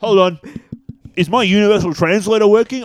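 sci-fi crew voice. Pretend. Playful sci-fi character bit.

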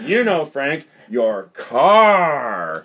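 "your car" leaned on, loud and falling; slow knowing hint on "your car"; pointed intonation. Retold or imitated. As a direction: nudge him with the fall on "car". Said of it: "What he did pick up was the intonation".

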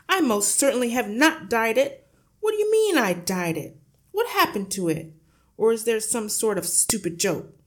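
loud, high, outraged denial; flustered father blustering; indignant yelling. A narrator's reading. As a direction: protest loudly, firing questions at them. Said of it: "the father yelled".

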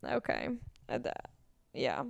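very quiet, low, deflated voice; embarrassed mumble. Retold or imitated. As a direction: shrink away, barely audible. Acting out a humbling, embarrassing moment.

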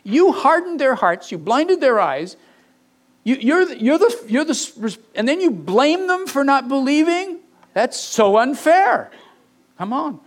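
high, indignant mock accusation against God; mocking complainer. Preacher mimics a skeptic accusing God, indignant and high. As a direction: whine it up high like a sulky accuser. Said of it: "It sounds like".

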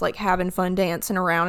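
ordinary talk, nothing marked

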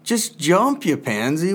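high, impatient mock scolding; exasperated goading. Dad to daughter afraid to jump, high exasperated goading. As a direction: re-enact urging a hesitant kid on. Retells exasperation at hesitant child.

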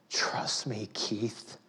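soft whispered plea; tender whispered invitation. Pretend. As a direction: whisper it as God. Voicing God tenderly.

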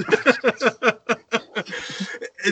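long airy laugh; laughter. Real, not acted. Teller laughing after the hoo-ha, long laughter.

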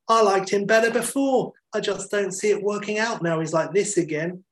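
put-on high, whiny girlfriend voice; lightly mocking daytime TV guest; talk-show girlfriend. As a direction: complain to Trisha in a put-on woman's voice. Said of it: "addressing Trisha".

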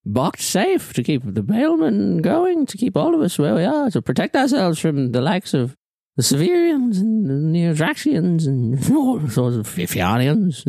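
a scheming NPC justifying the prison; villain's persuasive pitch. Acted. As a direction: sell the cause sweetly. GM voices a character.

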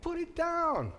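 high, soft, slow rebuke; calm a frightened friend quietly; gentle master. Acted. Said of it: "The master turns to Peter and says".

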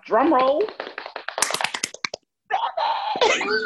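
big reveal. Real, not acted. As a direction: announce it like a drum roll. Announcing number of kids.

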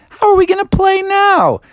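high-pitched dismayed complaint; comic exasperation, voice way up; exasperated. Acted. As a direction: wail at the teammate who spoiled the game. Team dismayed, ball eaten.

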